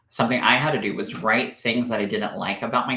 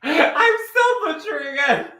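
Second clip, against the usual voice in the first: high, loud squeal of a confession; helpless laughter; 5 dB louder than usual. Real, not acted. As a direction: wail it while losing it laughing. Cracking up over slip.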